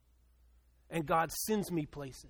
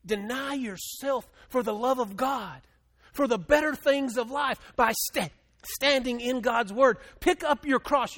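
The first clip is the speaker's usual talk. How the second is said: preaching at full force; 6 semitones higher, 5 dB louder than usual. Acted. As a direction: drive each line home from the pulpit. Preaching to the congregation.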